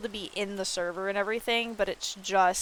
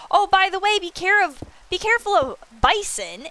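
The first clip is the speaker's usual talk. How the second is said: much higher, breezy tour-guide voice; tribemates' breezy tour; 10 semitones higher, 6 dB louder than usual. Retold or imitated. Streamer mimicking her tribemates showing her the area, breezy high voice. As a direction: chirp it off like a careless tour guide. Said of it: "And they're like".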